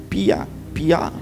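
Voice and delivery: chick peeping. Retold or imitated. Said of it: "quando o pintinho".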